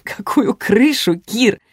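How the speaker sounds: mild mock-incredulous amusement; amused, laughing. A narrator's reading. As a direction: ask it as if overcome with mirth. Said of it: "я расхохоталась".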